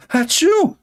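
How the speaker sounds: hammed-up sneeze sound; fake sneeze. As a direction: throw out a big pretend achoo. Mock sneeze mocking hadith.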